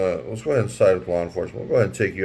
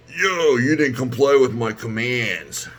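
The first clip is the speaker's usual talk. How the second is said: drawn-out, higher-pitched mock cop voice; exaggerated cop impression, pitched up; 4 semitones higher, slower than usual. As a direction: stretch it out slowly, imitating an officious cop. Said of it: "You got cops out there".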